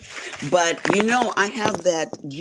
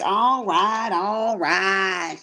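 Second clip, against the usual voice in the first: high, drawn-out country "all right"; playful country-accent mimicry; 5 semitones higher, much slower than usual. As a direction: imitate a friend's country accent, pitched up. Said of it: "She's so country".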